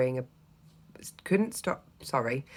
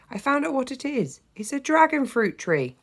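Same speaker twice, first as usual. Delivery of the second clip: put-on high breathless child's voice; out of breath; 11 semitones higher, 4 dB louder than usual. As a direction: gasp it out, still winded. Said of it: "trying to catch my breath".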